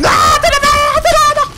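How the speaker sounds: very high, loud shriek; real terror at a shark; panicked scream. Real, not acted. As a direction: scream in sudden fright. Shark appears suddenly in game.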